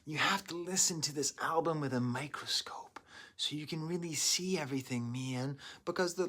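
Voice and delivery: unvoiced whispered redo. Shown as a demonstration. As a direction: breathe it out in a whisper. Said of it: "I sound like a stoner".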